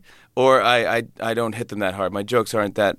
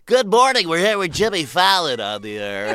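morning zoo DJ; 6 semitones higher than usual. Retold or imitated. Morning zoo host greeting listeners, hammed-up DJ. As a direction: boom it out like a morning DJ. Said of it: "I'm like".

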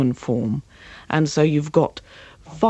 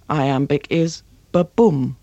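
"ba-boom" said slowly, the stress landing on "boom"; ba-boom heartbeat rhythm. Shown as a demonstration. Coach showing the iambic beat, slow and rhythmic. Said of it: "which is the heartbeat".

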